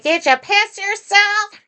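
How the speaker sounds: high, slow mocking neighbor voice; imitating the neighbor. Retelling the neighbor's question to listeners, high and slow. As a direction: stretch it out high like the nosy neighbor. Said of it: "to be like".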